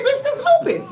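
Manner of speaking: high-pitched, triumphant call; mock-triumphant retold exclamation; excited discovery. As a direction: announce the diagnosis like you cracked the case. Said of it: "I said".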